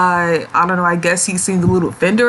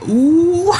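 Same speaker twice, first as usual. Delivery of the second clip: shady ooh; 10 semitones higher than usual. Pretend. Reacting to Earth's dig.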